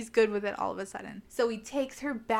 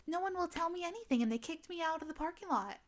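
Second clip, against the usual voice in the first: quick, higher, softer mock complaint; retold complaint; 7 semitones higher, 5 dB quieter, faster than usual. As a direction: rattle off a put-on grievance, high and hushed. Retold friend's complaining message.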